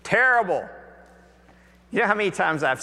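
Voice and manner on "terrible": glum reply. Retold or imitated. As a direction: groan it out like a fisherman with an empty net. Weary fishermen answer from boat.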